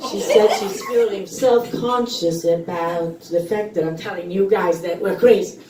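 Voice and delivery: ordinary talk, nothing marked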